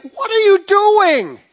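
high, slow, incredulous wife voice; baffled wife. Retold or imitated. Wife woken late asks her husband what he's doing, incredulous. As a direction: raise your pitch and drag out the disbelief. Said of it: "Stacy's like".

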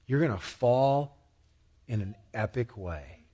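soft, low, tender voice of Jesus; hushed, sorrowful caution; gentle warning. Acted. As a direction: quietly warn a friend who won't listen. Jesus gently warns Peter.

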